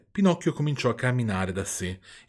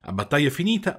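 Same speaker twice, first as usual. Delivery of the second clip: ordinary delivery, nothing marked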